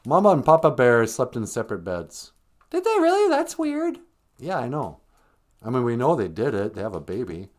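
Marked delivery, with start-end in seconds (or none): 2.7-4.0 very high interjecting character voice; high-pitched, incredulous little sidekick; squeaky sidekick. Pretend. Sidekick voice questions the storyteller.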